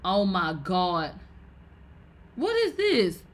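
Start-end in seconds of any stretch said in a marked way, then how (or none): 2.3-3.2 high, startled question; surprised. Real, not acted. Startled by what pops up on screen. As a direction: shoot it up in shock.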